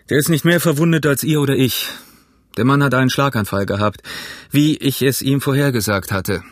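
firm, authoritative doctor's voice; brusque doctor. Acted. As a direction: give brisk orders as the doctor. Doctor figure gives orders. (resoluter, bestimmter Doktor)

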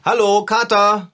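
loud, slow, drawn-out greeting; loud slow call. Acted. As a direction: call him out slowly and loudly. Calling to friend with earplugs. (lautes, langsames Rufen)

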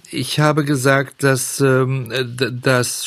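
slow, halting stammer with false starts. Acted. Puppet boy stammering excuses to the fairy. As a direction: trip over the words like a caught schoolboy. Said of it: "stotterte Bengele".

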